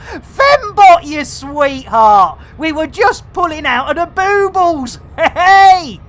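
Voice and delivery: loud, delighted shouting at the screen; excited cheer. Real, not acted. Streamer cheering viewer Fembot's arrival, loud excited shout. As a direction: yell it out like a pub landlord greeting a regular.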